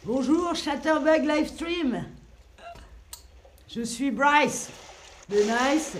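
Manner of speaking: loud, projected greeting to the stream; calling out. Real, not acted. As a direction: call out the introduction, punching each phrase. Calls out greeting to livestream from paddleboard.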